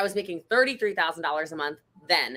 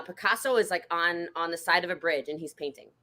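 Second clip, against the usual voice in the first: usual speaking voice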